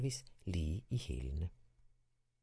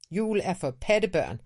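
higher, louder scoffing line; scornful snort; 9 semitones higher, 7 dB louder than usual. A narrator's reading. Boy scoffs at Christmas to his dad. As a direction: sneer it like a fed-up boy. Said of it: "fnøs Tiberius".

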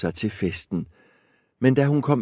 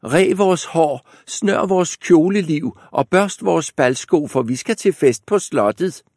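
spoiled sisters barking out chores; bossy stepsisters; 9 semitones higher, 5 dB louder than usual. Acted. Stepsisters order Askepot to ready them, high and bossy.

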